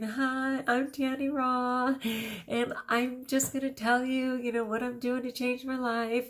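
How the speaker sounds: high, cutesy imitation of a first video; Tanny Raw. Woman introducing herself in her first video, high imitated voice. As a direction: pitch it up, like a simple little video introduction. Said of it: "video that she held up for the first time saying".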